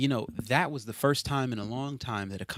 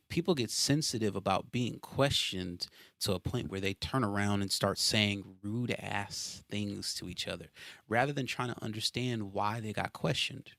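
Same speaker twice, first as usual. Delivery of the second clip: ordinary talk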